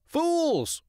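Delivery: "Fools!" very high and shrill; squawky Fools. Acted. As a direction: caw "Fools!" gleefully. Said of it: "The crow agreed wholeheartedly".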